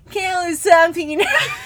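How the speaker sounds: high, loud, rushed mock wail; hammed-up outburst dissolving into laughter; weirdest way. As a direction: imitate her overwrought cry, cracking up. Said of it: "the weirdest way".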